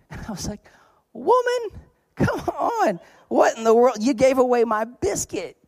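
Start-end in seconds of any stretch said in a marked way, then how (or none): 1.1-5.6 high, louder, exasperated complaint; mock angry outburst. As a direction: act out your own indignant rant over the biscuit. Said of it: "I remember getting angry".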